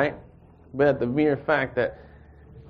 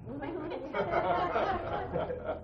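a very high breathy laugh; high airy laugh; 9 dB quieter than usual. Real, not acted. Laughs at own joke.

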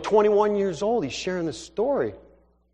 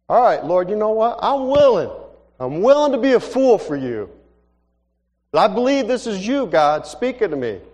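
raised, louder declaration to God; fervent surrender; 6 semitones higher, 5 dB louder than usual. Acted. Young man tells the Lord he's willing, fervent raised voice. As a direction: give in out loud with high, emphatic conviction.

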